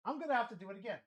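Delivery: trailing off into a mutter; muttered to himself. Real, not acted. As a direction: mumble it as an afterthought.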